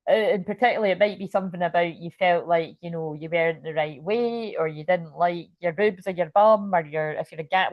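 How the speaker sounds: ordinary talk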